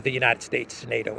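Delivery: ordinary talk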